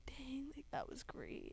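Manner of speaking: breathy hushed whisper. Retold or imitated. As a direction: whisper it in blissful awe. Recalling a peaceful prayer moment.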